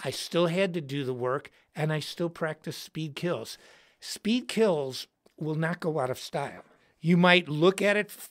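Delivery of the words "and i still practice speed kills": ordinary talk, nothing marked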